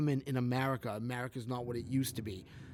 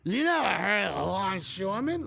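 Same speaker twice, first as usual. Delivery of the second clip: louder, pitched-up mock mobster; mafia tough guy; 6 semitones higher, 5 dB louder than usual. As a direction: threaten like a union boss mobster. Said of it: "mafia Tony Soprano impersonation".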